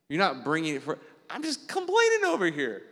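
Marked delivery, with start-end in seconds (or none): 1.3-2.9 much higher mock whiny voice; whiny complainer. Mocking people who pray by complaining: whiny. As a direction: mimic a petty complainer.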